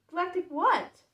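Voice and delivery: high, loud confused question; puzzled, as if you misheard; perplexed. Acted.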